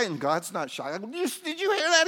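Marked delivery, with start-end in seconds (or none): 1.1-2.1 very high, loud squeal; hammed-up, aghast outcry; mock alarm. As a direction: squeal the question in comic panic. Acts himself asking God, aghast.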